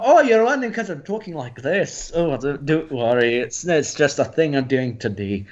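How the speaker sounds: put-on subtle Scottish accent, starting high then settling; breezy explanation with a Scottish accent. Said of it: "I just talked with a Scottish accent".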